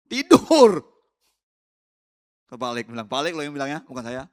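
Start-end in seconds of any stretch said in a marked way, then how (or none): 0.1-0.8 very high, very loud yell; mimicked shouting. Preacher mimicking the neighbours' early-morning cries, shouted high. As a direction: holler it out in imitation. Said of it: "mereka sudah teriak-teriak".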